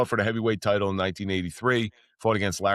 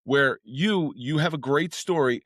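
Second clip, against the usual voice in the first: normal talking voice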